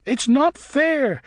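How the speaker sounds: put-on girl bat wailing over her death. A narrator's reading. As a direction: stretch it into a howl of grief. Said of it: "she wailed".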